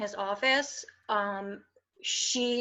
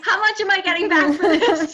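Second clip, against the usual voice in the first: high, loud question through laughter; laughing mock complaint; 8 semitones higher, 8 dB louder than usual. Patient joking about a refund for her body, laughing mock complaint. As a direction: demand the refund, cracking up.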